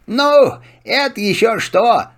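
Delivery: humble servant donkey. Acted. Donkey speaks. (голос осла)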